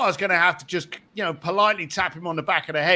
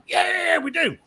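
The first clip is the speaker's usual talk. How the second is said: eager yes; 9 semitones higher than usual. Retold or imitated. Clients eagerly agreeing to meet Elon.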